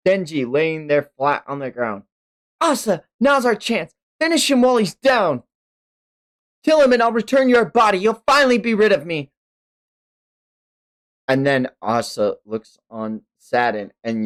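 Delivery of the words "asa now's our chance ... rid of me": higher, faster urgent push; higher eager Yoru voice; urging Yoru. Acted. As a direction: tempt her to strike now.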